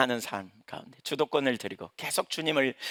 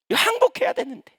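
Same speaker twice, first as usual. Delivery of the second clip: pitched much higher in earnest plea; a high, pleading preacher's cry; fervent cry; 5 dB louder than usual. Real, not acted. Preacher cries out.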